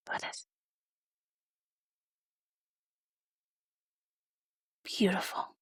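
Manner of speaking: soft awed whisper; hushed whisper. Real, not acted. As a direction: breathe it out in a whisper. Hushed over the compacts.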